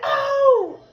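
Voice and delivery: very high, startled laughing oh; a sudden high gasp of an oh. Retold or imitated. Mother-to-be as her water breaks, startled high laughing oh.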